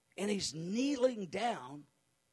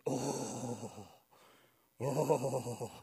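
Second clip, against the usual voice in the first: low, shuddering "oh"; long low groans, heavily dramatic; 7 semitones lower, 3 dB quieter than usual. Retold or imitated. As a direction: imitate a solemn, overcome groan. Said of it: "he was very serious and dramatic".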